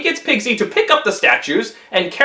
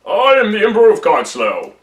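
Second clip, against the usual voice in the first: a hint of a regal emperor. The Emperor introduces himself.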